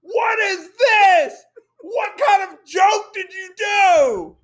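wife yelling at him over the toilet; imitating a yell. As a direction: hurl the question downstairs angrily. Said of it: "I just hear".

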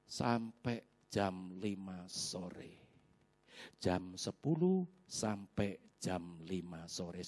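slow, hushed, dragging word by word; weary drawn-out. Retold or imitated. Preacher telling congregation about circling till evening, slow and weary. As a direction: stretch it out low and softly, with pauses.

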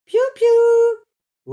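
very high, very loud chick cries; chick peeping. Acted. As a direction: peep like a newborn chick. La poussine piaille. (la poussine piaille très aigu)

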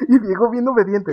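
normal talking voice

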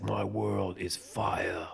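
breathy movie-trailer whisper; whispered trailer line. Retold or imitated. Imitating the hero in a slow trailer whisper. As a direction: hush it like a dark trailer.

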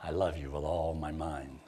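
a lover murmuring I love you with all my mind, low and soft; mock tender, low and soft. Shown as a demonstration. Low, soft, mock-romantic. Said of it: "think how that sounds to some".

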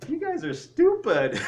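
high, loud, slow taunt; hammed-up ridicule, like a crowd jeering from the walls; mocking jeer. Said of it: "where they're mocking you and really ridiculing you".

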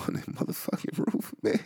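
words squeezed out through giggling; can barely get it out for laughing; breathless laugh. Real, not acted. Laughing under his breath at people stranded on roofs.

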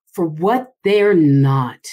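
slow, drawn-out "for what they're not"; playing with the voice. Shown as a demonstration. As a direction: stretch out "for what they're not", every word weighed. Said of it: "play with your voice".

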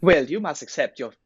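parodying a bad British accent; mock British accent. Friend joins the mockery, in fake British accent. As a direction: ham the fake British line. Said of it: "That British accent".

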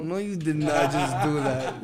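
slow, laughing disbelief. Real, not acted. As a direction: laugh it out, stunned. Reacts to pun.

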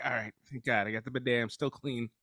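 quiet, low, relieved mutter to himself; relieved inner thought. Retold or imitated.

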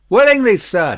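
eager shopkeeper. Acted. Defarge answers the gentleman.

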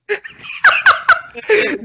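loud laugh. Real, not acted. Bursts out laughing.

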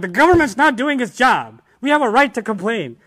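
hammed-up outraged protester; mock angry protester. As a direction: shout it like a crowd of protesters. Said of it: "And when they complain, like".

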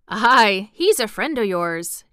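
usual speaking voice

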